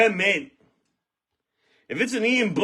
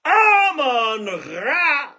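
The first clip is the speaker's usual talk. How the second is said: excited cry; 5 semitones higher, much slower than usual. Real, not acted. Big card pull, shouting names.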